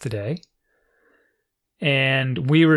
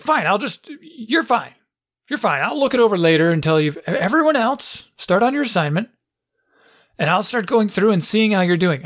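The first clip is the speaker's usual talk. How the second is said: higher-pitched teacher giving in impatiently; brusque teacher impression; 6 semitones higher than usual. Teacher letting Max off and starting class, brusque. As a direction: imitate a harried teacher moving the class along. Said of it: "this is how she sounds".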